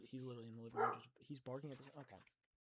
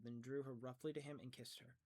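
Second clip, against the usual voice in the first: plain reading voice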